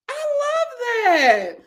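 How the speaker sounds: high, drawn-out swoon; gushing delight. Real, not acted. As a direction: let it ring out high and slow. Gushing over wedding tradition.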